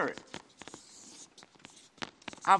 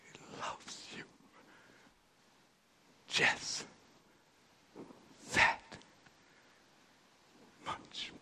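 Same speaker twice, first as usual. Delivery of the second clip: whispered, slow, spaced out word by word; tender whisper after the shouting; sudden whisper; 16 dB quieter, slower than usual. Acted. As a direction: drop to a breath to make them lean in. Hushed climax after loud preaching.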